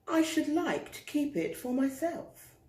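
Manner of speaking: high, lofty giraffe voice; haughty giraffe. Acted. Giraffe keeping the hat, high and lofty. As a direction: look down your nose at the pig. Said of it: "she said".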